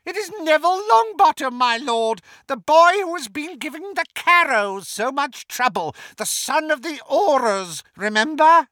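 delighted, very high and loud villainess; Bellatrix. A narrator's reading. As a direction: crow it gleefully to your master. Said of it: "Bellatrix gave a delighted laugh".

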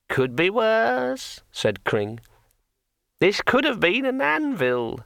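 high, slow, chatty sword voice; a cheerful magic sword rambling on; talking sword. A narrator's reading. Sword Kring speaks.

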